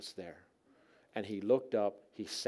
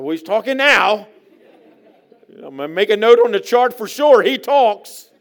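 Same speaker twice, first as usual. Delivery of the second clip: loud, high shouted comeback; triumphant raised-voice correction; emphatic retort; 12 semitones higher, 22 dB louder than usual. Acted. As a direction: holler the proof at the doubters. Retorts to those claiming he's mute.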